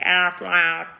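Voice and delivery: a playful quacked Aflac; Aflac said the duck's way. Shown as a demonstration. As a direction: squawk Aflac like the ad duck. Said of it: "Not Aflac".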